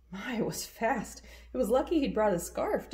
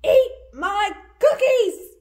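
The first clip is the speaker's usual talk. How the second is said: high loud yell, the end slow and drawn out; crying out; 12 dB louder, slower than usual. Acted. Boy yelling at the wolf to eat his cookies instead. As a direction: yell to save her, offering the cookies. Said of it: "he cried".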